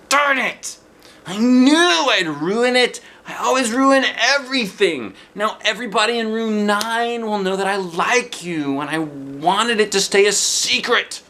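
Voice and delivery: put-on high, loud boy's voice, wailing that he ruined it; a flustered boy blurting his secret; upset boy. A narrator's reading. Jim wails to Junie B. that he ruined everything.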